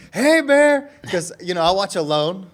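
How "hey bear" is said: high, loud shout to scare off a bear; screamed bear warning. Retold or imitated. Rider scaring off a bear on the trail, screaming. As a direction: belt it out high and urgent. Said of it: "I start screaming".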